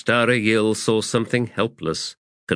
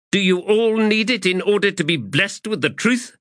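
much higher, biting rhetorical questions; challenging; 12 semitones higher than usual. Acted. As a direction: scorn them with each question. Adjunct confronts the officers.

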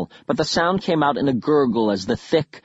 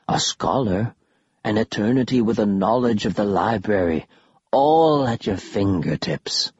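eerie whisper; 4 semitones lower than usual. Acted. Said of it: "another whispered".

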